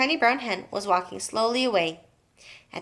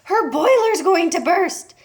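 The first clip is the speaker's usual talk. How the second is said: panicked wail; 9 semitones higher, 7 dB louder than usual. A narrator's reading. Panicked wife.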